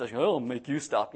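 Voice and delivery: low, slow muttered threat; a quiet menace under his breath; irritated. Retold or imitated. Preacher voicing his irritation at the driver, low and slow. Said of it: "I get a little irritated".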